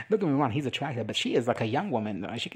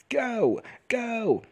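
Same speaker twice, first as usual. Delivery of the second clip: shouting go; 9 semitones higher than usual. Retold or imitated. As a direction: bark him out the door. Crowd urging him to leave.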